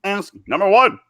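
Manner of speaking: hammed-up character line; impression bit. Shown as a demonstration. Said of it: "I love doing that one".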